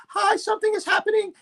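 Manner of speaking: mock the caller's over-acted sobbing, way up high; mock fake crying. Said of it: "She's very exaggerated with an exaggerated sense of crying".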